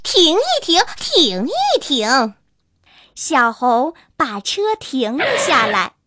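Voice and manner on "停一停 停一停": fox calling out. A narrator's reading. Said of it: "狐狸大叫起来".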